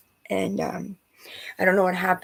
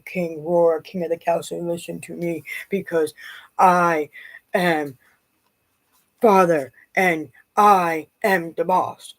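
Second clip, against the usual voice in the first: slow, mock self-important father, louder on the end; pompous boss father; 3 dB louder, slower than usual. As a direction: parody him playing king of the castle. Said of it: "because he's like".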